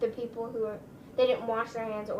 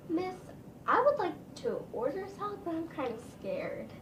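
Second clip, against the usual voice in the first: lightly voiced grandma, higher and timid; a hint of a nervous old lady ordering; 6 semitones higher, 3 dB quieter than usual.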